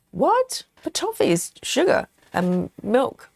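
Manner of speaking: shocked, high-pitched "what"; sudden incredulous what; surprised. Real, not acted. Surprised at sugar-free toffee.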